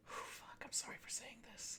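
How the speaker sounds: breathless soft whisper; boy whispers an apology for swearing, very softly. Acted. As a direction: whisper it, embarrassed. Kyle overwhelmed, swears softly.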